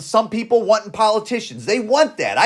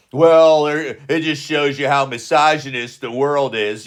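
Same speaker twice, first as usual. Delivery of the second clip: mock complainers; 6 semitones lower than usual. Mimics people blaming misogyny for loss.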